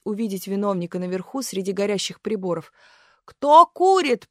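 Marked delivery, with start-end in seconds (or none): 3.2-4.3 высокий, громкий, медленный крик с ненавистью; прокричи, задыхаясь от злости; яростный крик. A narrator's reading. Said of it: "прокричал павильонный пожарник, задыхаясь от ненависти". (пожарник в ярости кричит на курильщика)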